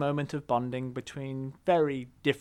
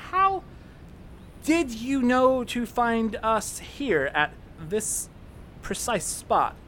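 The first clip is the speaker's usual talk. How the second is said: drawn out, high, accusing; slow suspicious question; 7 semitones higher, slower than usual. Acted. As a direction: stretch each word with suspicion. Suspicious pointed question to Terrathor.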